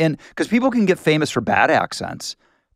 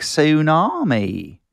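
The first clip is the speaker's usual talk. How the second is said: slow, drawn-out Southern "Tsunami"; Southern accent; 4 semitones higher, much slower than usual. Retold or imitated. Duke fans quoting the line, in a slow Southern accent. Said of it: "and everybody was like".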